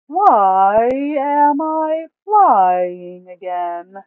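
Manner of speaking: high, loud, slow Duke voice, puzzled. Acted. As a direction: wonder aloud, dragging each word. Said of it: "Duke mutters to himself".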